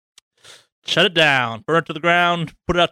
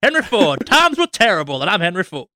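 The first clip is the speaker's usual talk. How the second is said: Henry Ford voice; 9 semitones higher, 3 dB louder than usual. Acted.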